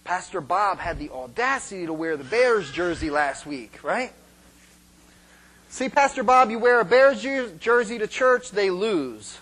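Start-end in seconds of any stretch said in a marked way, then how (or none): none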